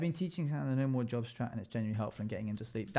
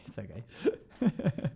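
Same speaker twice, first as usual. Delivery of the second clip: laughing; 2 semitones higher, much slower than usual. Real, not acted. Laughing reassurance.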